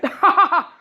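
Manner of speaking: high bright laugh burst; burst of laughter. Real, not acted. Storyteller cracking up at the tease, high laugh. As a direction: crack up at the joke.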